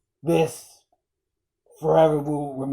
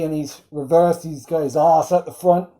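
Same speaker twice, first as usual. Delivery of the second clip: ordinary talk, nothing marked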